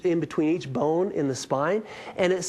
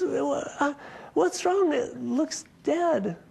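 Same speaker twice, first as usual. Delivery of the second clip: puzzled question; 9 semitones higher, much slower than usual. Retold or imitated. Teller puzzled.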